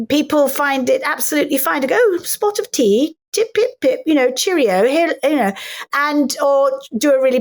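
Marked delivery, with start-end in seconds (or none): 2.0-4.8 mock English accent. English actress imitating Americans teasing her, mock English accent. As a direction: put on a jolly caricature of an English accent. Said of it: "being English in America ... fine to go".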